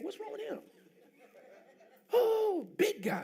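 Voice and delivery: high laughing mock fright. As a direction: laugh through the scared reaction. Laughing at his own fright.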